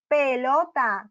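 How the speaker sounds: Pelota stretched slowly into its three syllables. Shown as a demonstration. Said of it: "Tiene tres sílabas".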